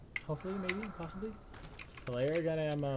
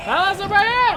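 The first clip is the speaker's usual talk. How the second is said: high, loud calling shout; 15 dB louder than usual. Real, not acted. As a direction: bellow it out across the room. Shouting back at a game character.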